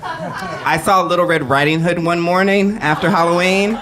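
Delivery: ordinary talk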